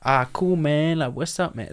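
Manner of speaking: casual imitation of an American greeting; mock American. Showing how he might greet an American, mock American accent. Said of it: "like, an American and be like".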